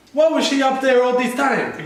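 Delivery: high, loud Wakandan accent impression. Fan imitating Shuri in Infinity War, Wakandan accent. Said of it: "Nice Wakandan accent as well".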